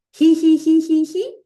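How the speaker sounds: high giggling he-he-he; high hee laugh. Shown as a demonstration. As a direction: giggle it up in the throat. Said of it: "repeat to yourself or out loud".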